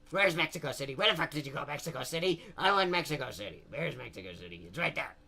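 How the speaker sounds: low, quiet muttering to self; muttered search. Real, not acted. Comedian hunting for Mexico City on the map, muttering. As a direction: mumble it under your breath, low.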